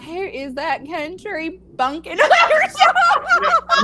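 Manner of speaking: slow, high, laughing mock of a critic; mock listener. Imagined listeners mocking the country bumpkin, slow and high with laughter. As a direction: laugh through an imagined heckler. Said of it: "I know people are like".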